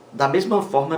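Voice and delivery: normal talking voice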